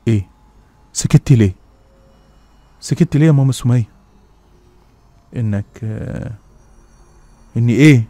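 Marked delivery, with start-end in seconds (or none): 0.9-3.9 louder, pressing question; anxious urging. Acted. Urging her to finish. 7.6-8.0 loud sharp question; alarmed demand. Acted. Demanding the rest.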